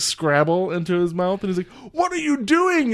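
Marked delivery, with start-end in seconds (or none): 1.9-2.9 high, drawn-out incredulous question; hammed-up exasperated cry; mock outrage. Retold robber scolds partner.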